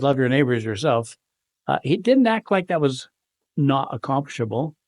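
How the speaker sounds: ordinary delivery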